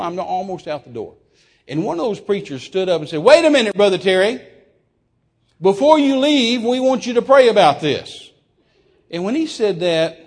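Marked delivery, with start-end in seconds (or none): 3.2-8.3 high, loud interruption; calling out. Retold or imitated. Preacher halting a guest at the door, calling out. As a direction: stop someone leaving with a raised voice.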